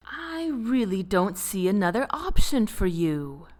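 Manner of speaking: usual speaking voice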